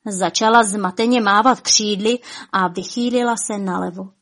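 ordinary delivery

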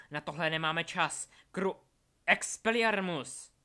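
loud urgent outburst, then a spell; shouted spell casting. A narrator's reading. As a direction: shout it out, stretching the last incantation.

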